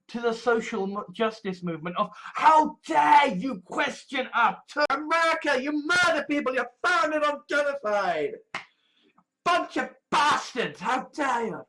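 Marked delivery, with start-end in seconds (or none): 2.3-11.6 high-pitched shouted mock indignation; anger. Activist rails at America over being questioned, angry. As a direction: imitate an outraged activist hurling accusations. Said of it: "there is this anger".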